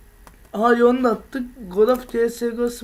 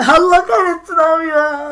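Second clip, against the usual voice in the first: laughing exclaim; 6 semitones higher, 8 dB louder than usual. Real, not acted. Laughing exclaim at game moment.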